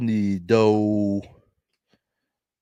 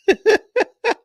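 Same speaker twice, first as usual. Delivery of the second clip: high squealing laugh; 6 dB louder than usual. Real, not acted.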